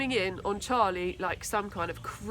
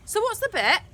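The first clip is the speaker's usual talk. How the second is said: higher, louder reckless challenge; reckless demand; 11 semitones higher, 5 dB louder than usual. A narrator's reading. Tina recklessly challenging Charlie, higher and louder. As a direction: dare him without thinking. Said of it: "demanded Tina recklessly".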